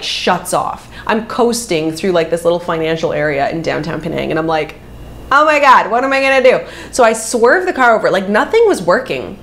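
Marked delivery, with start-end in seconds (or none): 5.3-6.6 higher, louder mock alarm; stranded and panicking, retold; raised-voice replay of a panicked moment. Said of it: "I'm starting to panic".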